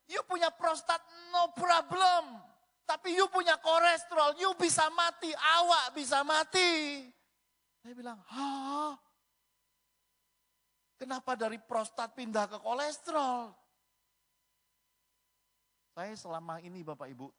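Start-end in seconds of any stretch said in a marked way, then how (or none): 0.1-7.0 very loud, very high shouted warning; imitated doctor. Doctor warning patient about cholesterol, hammed-up high shout. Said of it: "dokternya ngomongnya gitu". 8.3-13.5 high, loud double take; startled disbelief. Retold or imitated. Patient baffled by doctor's switch to cholesterol, high and loud.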